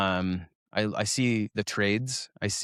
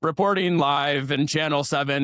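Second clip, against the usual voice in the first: lightly voiced TV reporter, pitched up; the teacher hamming a live broadcast; news reporter; 4 semitones higher than usual. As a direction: do a playful on-air reporter. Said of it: "and he's like".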